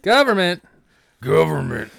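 "Government" said high and loud, throaty. Retold or imitated. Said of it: "That's how you have to say it".

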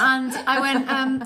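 usual speaking voice

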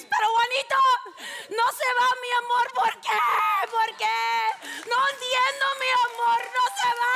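telenovela scream. Retold or imitated. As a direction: wail it like a novela heroine losing her man. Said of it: "and she screams".

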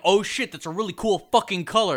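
loud, high-pitched mock amazement; mock excited discovery. Co-host acting out whoever named the color orange, loud mock excitement.

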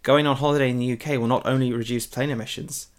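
ordinary delivery

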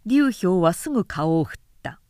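ordinary delivery, nothing marked

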